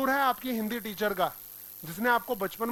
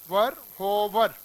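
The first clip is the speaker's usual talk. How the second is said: वर and hover spelled out slowly, वर stressed; slow emphasis; 4 dB louder, much slower than usual. Shown as a demonstration. Teacher marking words to underline, slow and stressed. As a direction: lean on वर, then hover, slowly. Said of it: "दोनों को underline करिए".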